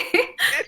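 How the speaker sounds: cackling witch laugh. Pretend. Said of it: "My witch laugh".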